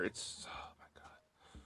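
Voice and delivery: whisper. Real, not acted. Exasperated aside to viewers.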